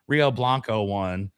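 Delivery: ordinary talk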